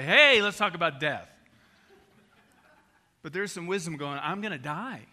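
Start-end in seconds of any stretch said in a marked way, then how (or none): 0.0-1.3 much louder chirpy invite; mock cheerful invite. Friend at a nacho night, a mock loud cheerful invite. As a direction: pitch it as party fun. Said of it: "have nachos and say".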